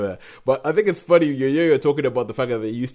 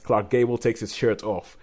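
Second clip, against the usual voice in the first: usual speaking voice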